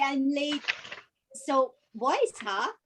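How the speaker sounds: usual speaking voice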